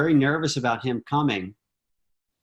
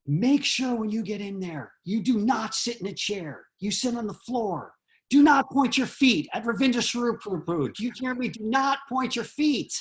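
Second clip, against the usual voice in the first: mock-stern instructions, pitched higher; bossy elder laying down the rules; stern warning; 7 semitones higher than usual. Bhakta leader drilling a nervous newcomer, higher stern warning. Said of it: "He was like".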